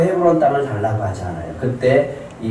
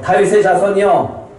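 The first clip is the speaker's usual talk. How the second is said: high, raised call to Jesus; pleading call; 7 semitones higher, 4 dB louder than usual. Acted. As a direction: call out for his attention. Woman calls to Jesus for mercy.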